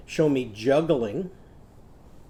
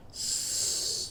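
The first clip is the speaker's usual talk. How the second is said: "Spot" whispered softly; 7 dB quieter than usual. Shown as a demonstration. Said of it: "guess my next word".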